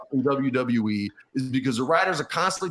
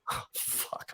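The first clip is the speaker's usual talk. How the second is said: whispered curse; 16 dB quieter than usual. Real, not acted. Realizing he's about to be fired.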